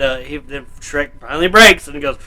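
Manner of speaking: ordinary delivery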